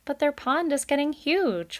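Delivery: high, lightly voiced child protesting; a young kid pushing back; child objecting. A narrator's reading. Child objects to father about beavers, high protest. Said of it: "one of the children objected".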